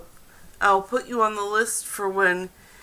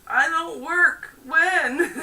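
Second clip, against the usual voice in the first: lightly voiced quote, a little higher; mock card voice; 7 semitones higher, 4 dB louder than usual. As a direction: read the card's words in a playful raised voice.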